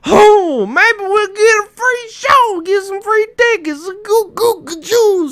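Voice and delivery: very high, loud mock-excited character voice; hammed-up movie old man. Podcaster imitating old man spotting a circus tent, hammed-up excitement. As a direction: drag it out high and silly. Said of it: "And he's like".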